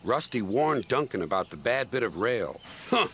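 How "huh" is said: high, huffy engine voice; scornful and stubborn, pitched up. A narrator's reading. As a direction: dismiss it crossly in a raised voice. Voices Duncan refusing the warning.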